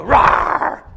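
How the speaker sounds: loud, high mock lion roar; a playful lion roar for the congregation. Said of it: "He would go".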